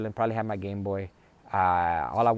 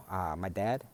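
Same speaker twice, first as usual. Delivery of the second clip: usual speaking voice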